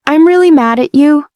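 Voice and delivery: ordinary delivery, nothing marked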